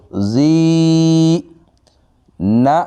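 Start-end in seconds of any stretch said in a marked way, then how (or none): 0.1-1.4 زی drawn out one alif, high and sung; elongated zee. Shown as a demonstration. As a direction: stretch the vowel of زی. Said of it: "ایک الف اتنا لمبا کریں گے زال کو".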